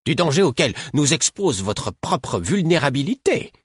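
a healer fed up with two fools; exasperated old woman. A narrator's reading. Caudron lecturing.

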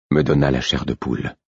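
plain reading voice

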